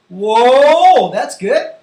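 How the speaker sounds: loud, stretched whoa of praise; big classroom encouragement; impressed teacher. Pretend. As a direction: act amazed at a pupil's answer. Praising pretend pupil's animal sound.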